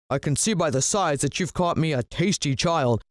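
lilting, put-on villain voice; villain praising his pet. A narrator's reading. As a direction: savour the coming meal in a character voice.

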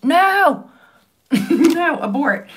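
high, loud cry of dismay; startled, drawn-out no; dismayed exclamation. Real, not acted. Dismay at stray lash.

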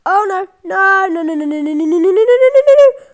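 frantic rising panic yell; panicked no no. Real, not acted. Panicked chicken about to be caught.

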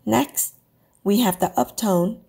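ordinary delivery

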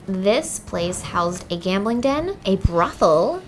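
drawn out, slow; slow dramatic reveal. Acted. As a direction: savour each word slowly.

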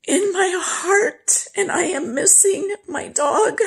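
high, crying voice, drawn out; real tearful grief. Real, not acted. Owner telling viewers about missing the dog, crying. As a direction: break into tears, pitch climbing high.

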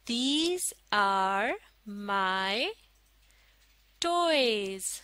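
very slow, a pause after each word; word-by-word model reading. Shown as a demonstration. Teacher models a sentence for learners, word by word. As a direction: space out every word for learners.

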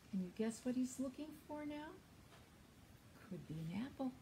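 storytime read-aloud; reading to children. Acted. Librarian reads a story to young children. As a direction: read the picture book to little ones.

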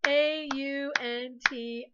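slow, letter by letter; spelling aloud. Shown as a demonstration. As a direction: clap out each letter slowly. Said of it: "clap a syllable".

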